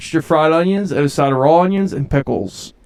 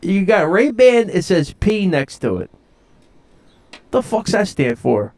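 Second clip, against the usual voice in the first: higher, brash South Philly tough guy griping; South Philly Matt; 5 semitones higher than usual. Acted. Host slips into his South Philly Matt character, brash and griping. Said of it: "now it's South Philly Matt again".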